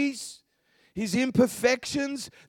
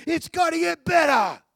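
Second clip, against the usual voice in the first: preaching shout; 8 semitones higher than usual. Real, not acted. Preaching climax.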